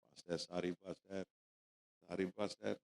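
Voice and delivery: very low, hushed mock apology; lost for words. Embarrassed host apologises to the pastor, imitated hushed speechlessness. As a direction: barely get the words out, softly. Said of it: "உனக்கு வார்த்தையே வரல".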